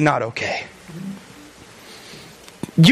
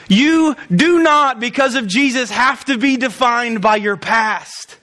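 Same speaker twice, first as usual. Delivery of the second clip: slow emphatic preaching; 2 semitones lower than usual. Acted. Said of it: "slow emphatic preaching point".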